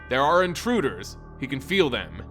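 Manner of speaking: ordinary delivery, nothing marked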